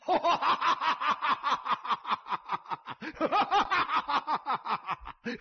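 long high booming guffaw; sudden roaring laugh in bursts; boss's loud, prolonged cackle; loud laughter. A narrator's reading. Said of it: "忽然大声笑道".

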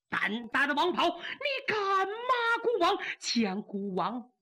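enraged ruler roaring the execution order; furious emperor. Acted. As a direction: rage building to a shouted command. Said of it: "可把赵光义气坏了".

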